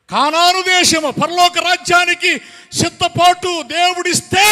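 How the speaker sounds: high, insistent sermon cadence; full-force preaching. Real, not acted. Preacher laments how they neglected salvation, full-force preaching. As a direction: drive each phrase home to the hall.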